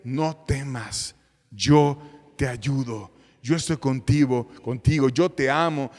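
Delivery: low, slow, gentle voice of God; tender reassurance. Acted. God comforts his frightened child: low, slow, tender reassurance.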